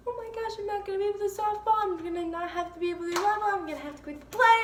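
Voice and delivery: high, fast tearful rush of worries; retold sobbing panic. Injured player wailing about missing every activity, high and fast. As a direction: gabble the fears out while crying. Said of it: "literally bawling my eyes out".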